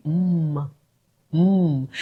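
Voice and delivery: a bare hummed "mm", no vowel; silly sound. Shown as a demonstration. Teacher quizzing pupils on a vowelless name, silly "mm". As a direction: hum "mm" alone as if it were a name. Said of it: "silly sound".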